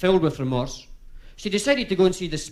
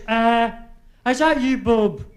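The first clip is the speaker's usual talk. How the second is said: high wifey voice; 5 semitones higher, 3 dB louder, slower than usual. Acted. Widow calls to dead husband.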